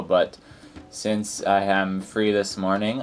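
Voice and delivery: normal talking voice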